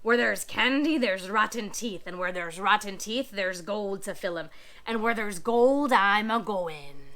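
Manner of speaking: a greedy pirate chanting his logic. Acted. Pirate Pete voice.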